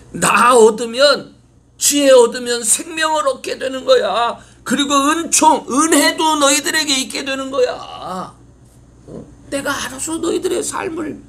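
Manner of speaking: high, loud fatherly pleading; God urging his children to listen. Acted. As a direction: coax the children to take wisdom. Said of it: "하나님께서".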